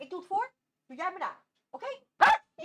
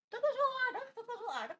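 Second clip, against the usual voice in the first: praise for the dog; 9 semitones higher, 5 dB louder than usual. Real, not acted. Praising dog after trick.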